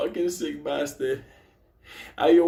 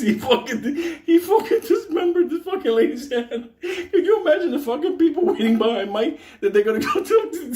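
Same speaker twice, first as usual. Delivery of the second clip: laughing while talking; 9 semitones higher, 3 dB louder than usual. Real, not acted. Cracking up retelling the finger.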